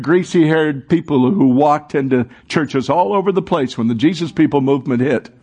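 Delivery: ordinary delivery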